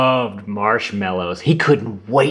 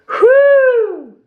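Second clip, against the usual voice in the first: loud high whew at a stink; disgusted whew; 6 dB louder than usual. A narrator's reading. Narrator reacting to the skunk spray, disgusted whew. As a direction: blow it out like a bad smell hit you.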